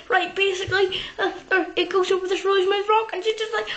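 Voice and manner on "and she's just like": usual speaking voice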